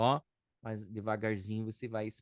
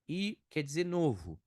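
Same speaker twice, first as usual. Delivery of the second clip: usual speaking voice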